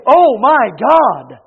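loud, drawn-out mock exclamation; imitated outburst of shock, slow and loud. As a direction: cry it out slowly as if shocked. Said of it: "when you see something that shocks you".

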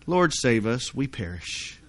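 low, soft, understated plea; mock composed recital. Doubting they said it like that, mock calm murmur. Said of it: "do you think they really said it like that?".

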